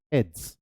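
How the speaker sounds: "Its" said as one syllable; contracted form. Shown as a demonstration. Teacher shows students how "Its" is pronounced, contracted form. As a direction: demonstrate "Its" run together as a contraction. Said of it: "pronunciation हमेशा contracted form में रखेंगे".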